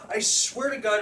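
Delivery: ordinary talk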